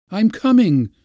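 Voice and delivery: high, loud call back; answering call. A narrator's reading. Praskovya answers her master's summons, calling back high. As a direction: holler back from another room. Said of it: "responded Praskovya".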